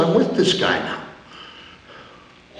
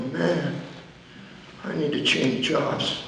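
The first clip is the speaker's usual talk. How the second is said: normal talking voice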